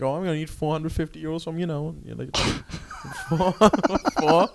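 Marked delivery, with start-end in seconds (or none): 0.0-1.9 Kerry landlord asking for money; a sly Kerry landlord; Kerry accent. Retold or imitated. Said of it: "That's actually a very good Kerry accent". 3.3-4.5 high incredulous squeak; shocked. Retold or imitated. Shocked tenant repeating price.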